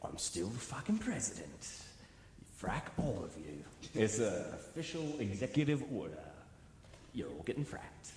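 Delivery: lightly voiced commander giving orders; mock presidential decree. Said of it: "he was just like".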